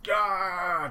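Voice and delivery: loud, high-pitched "Ah!" cry; acted scream. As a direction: scream out as a character suddenly struck. Character struck in manga being acted.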